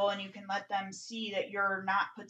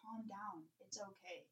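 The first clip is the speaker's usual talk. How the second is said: soothing daughter; 14 dB quieter than usual. Retold or imitated. Daughter voiced soothing mom.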